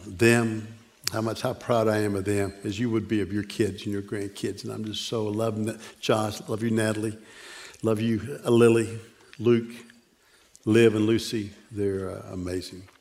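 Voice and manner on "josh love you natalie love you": ordinary talk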